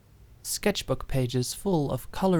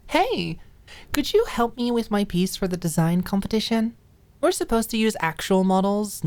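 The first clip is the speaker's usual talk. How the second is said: higher, eager asking voice; Maud's pleading text; 7 semitones higher, 4 dB louder than usual. Acted. Maud begging her roommate for modeling help, high and eager. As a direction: sweetly beg a favor by text.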